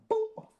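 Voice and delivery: high imitated boom; gunshot sound effect. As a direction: pop out the shot noise. Shooting the pigeon.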